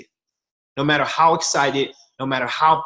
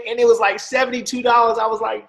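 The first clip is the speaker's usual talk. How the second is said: usual speaking voice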